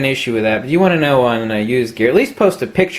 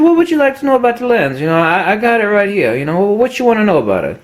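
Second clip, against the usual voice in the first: old salesman impression; 8 semitones higher than usual. Old clerk back on the phone with the lens, lightly voiced. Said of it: "he said".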